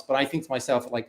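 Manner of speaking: ordinary delivery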